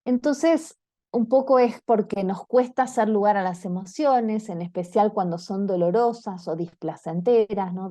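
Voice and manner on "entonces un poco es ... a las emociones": ordinary talk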